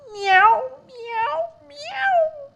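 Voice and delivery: very high mewing kitten cries; kitten meows. Acted. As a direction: mew like a sad little kitten. Kittens crying meow in poem.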